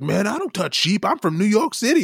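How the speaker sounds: a hint of a brash New Yorker, slowed; lightly mock the picky city tourist; overly American. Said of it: "overly American".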